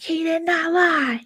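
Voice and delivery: high, drawn-out whiny protest; stretched, high mock outrage; mock indignant denial. Acting out Lumberjack arguing.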